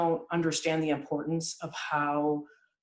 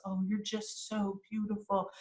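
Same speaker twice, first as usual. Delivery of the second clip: soft parentese; 3 semitones higher, 7 dB quieter than usual. Retold or imitated. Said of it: "mother comes in and says".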